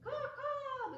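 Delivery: high cawing crow; crow call. Retold or imitated. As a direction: caw like the crow. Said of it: "The crow would caw".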